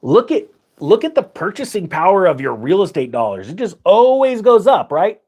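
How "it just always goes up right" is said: high, loud, mock-certain boast; mock confidence. Teacher mocking belief in ever-rising home prices, mock confidence. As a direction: push it up high like a gullible believer.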